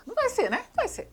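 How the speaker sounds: high sarcastic squeak. Acted. Vlogger mocking the girl's fanfics, high sarcastic voice. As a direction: jump way up for a mocking yes-it-will. Sarcastic answer to own question.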